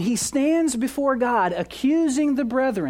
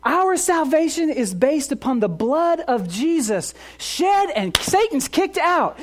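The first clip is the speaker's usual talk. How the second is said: normal talking voice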